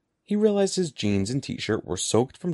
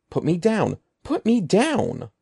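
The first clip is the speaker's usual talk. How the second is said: high, rising frantic plea; panicked pleading; 8 semitones higher, 3 dB louder than usual. A narrator's reading. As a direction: beg desperately to be let down.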